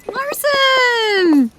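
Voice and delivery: high excited call across the office; eager high-pitched greeting shout. Acted. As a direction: sing out her name in surprise. Coworker calls out on seeing her.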